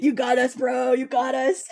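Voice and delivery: low mock-bro voice; lightly hammed fake deep voice; dropped stupid voice. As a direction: drop it low for a dopey confession. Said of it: "drop our voices down to, like, stupid".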